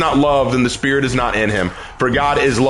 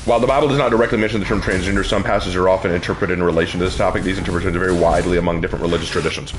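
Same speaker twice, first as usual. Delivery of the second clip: low, fast monotone read-out; rapid reading aloud; 8 semitones lower, much faster than usual. Real, not acted. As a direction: rattle through the written text, low. Reads out a text in monotone.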